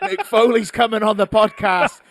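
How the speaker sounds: high, loud, drawn-out hype; mock excited announcement. Podcaster hyping an imagined Christmas guest, mock excited shout. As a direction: bellow it like a big guest reveal.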